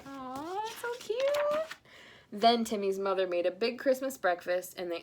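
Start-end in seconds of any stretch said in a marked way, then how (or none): 0.1-1.8 high, slow coo. Real, not acted. Reader cooing over the sweet ending, high and slow. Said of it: "reader's own reaction".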